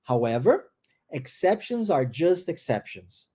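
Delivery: However, pause, then slowly: exceptions are just exceptions; rising However, a clear pause, then a slow steady fall; pause after However. Shown as a demonstration. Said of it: "Tá vendo que tem a vírgula, tem a pausa".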